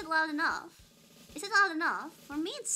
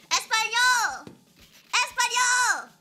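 playful shouts; 11 semitones higher, 11 dB louder, slower than usual. Pretend. Shouting out menu languages.

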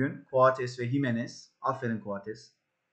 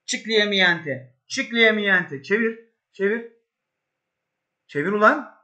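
high shouted commands; real excitement, pitch up; frantic urging; 8 semitones higher, 4 dB louder than usual. Real, not acted. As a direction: yell at the player to turn it in.

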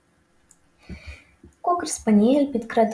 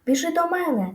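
yelling gander; 8 semitones higher than usual. A narrator's reading. Gander calling Wilbur over, yelling. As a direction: call out high like a gander. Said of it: "репетував гусак".

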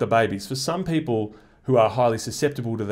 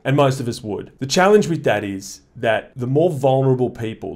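ordinary talk, nothing marked

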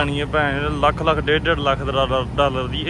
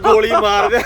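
loud mock threat; 5 semitones higher, 7 dB louder than usual. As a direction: shout it like a threat. Reading sign as a threat.

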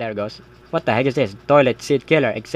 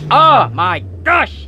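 shocked; 13 semitones higher, 5 dB louder, much slower than usual. Real, not acted. Jump scare reaction.

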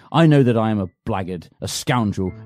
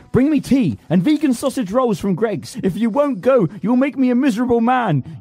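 frantic pleading; 7 semitones higher than usual. Acted. Pleading with servant.

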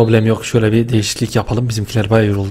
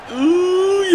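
anguished squeal. Real, not acted. Near miss in game.

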